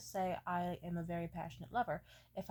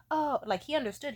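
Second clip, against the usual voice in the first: ordinary delivery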